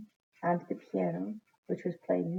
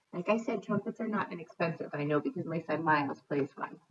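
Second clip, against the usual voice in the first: usual speaking voice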